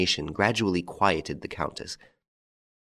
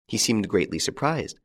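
plain reading voice

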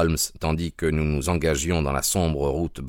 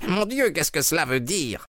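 high shocked outburst; cried out; 9 semitones higher than usual. Acted. Husband stunned by the masked child, high shocked cry. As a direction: gasp the question out in horror.

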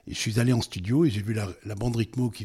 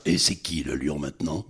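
low, mock-macho lion taunt; joke dubbed line; 7 semitones lower than usual. Dubber retelling his studio joke, low swaggering voice. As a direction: drop low and goof around with the line. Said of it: "je fais pour déconner comme ça".

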